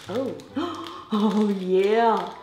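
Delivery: ordinary talk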